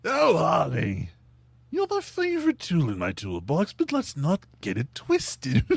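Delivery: put-on high, slow, theatrical old Hollywood villain; Joker voice. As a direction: purr it like a cartoon Joker. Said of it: "You know, it's like".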